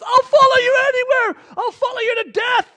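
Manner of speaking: very high, loud pleading cry; Peter's desperate cry. Acted. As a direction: swear loyalty at the top of your lungs. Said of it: "Peter jumps up and he goes".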